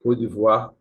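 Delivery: 프랑스어 발음으로 또박또박 "Côte d'Ivoire"; French pronunciation. Shown as a demonstration. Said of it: "eles falam".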